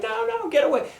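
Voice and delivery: high, frantic cry to get away; panicked shriek. Retold or imitated. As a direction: squeal in terror like someone being chased. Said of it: "And he's like".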